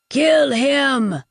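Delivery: loud roaring order; bellowed. Acted. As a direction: bellow down the hall. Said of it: "Hugh bellowed".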